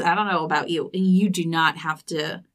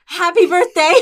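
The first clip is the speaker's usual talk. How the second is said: bright, high birthday greeting with a laugh; cheerful birthday wish; 9 semitones higher, 6 dB louder than usual. Shown as a demonstration. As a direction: sing out the wish, still giggly. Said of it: "We should redo it".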